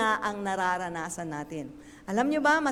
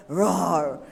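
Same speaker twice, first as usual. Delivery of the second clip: low, loud mock lion roar; a hammed-up lion's roar for the congregation; 7 semitones lower, 4 dB louder than usual. Shown as a demonstration. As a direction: roar like a lion to show it's only noise. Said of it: "Puro ganun lang yan".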